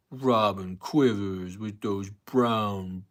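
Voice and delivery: slow, drawn-out put-on caller voice; Hate Man impression. Host briefly mimicking Hate Man's call, slow and odd. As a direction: drag it out like the crank caller. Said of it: "I can do a Hate Man impression".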